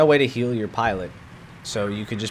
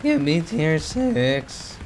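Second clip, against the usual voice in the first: drawn-out pleading; 7 semitones higher, much slower than usual. Pretend. Pleading for jets.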